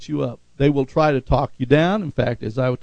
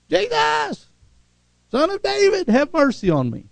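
high pleading cry to Jesus; the blind man's cry of faith, lifted high. Acted. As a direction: call out desperately, pitched way up. Bartimaeus crying out to Jesus.

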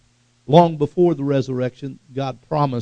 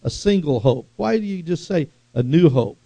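higher-pitched puzzled objector; questioning listener; 5 semitones higher than usual. Acted. Listener questions the preacher about new hope, higher puzzled voice. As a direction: voice a doubter pressing with questions. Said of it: "now you might say".

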